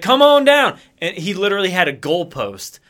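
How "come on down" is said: higher, louder pitchman call; Brad Benson impression. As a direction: sell it like a dealer ad. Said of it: "he's like".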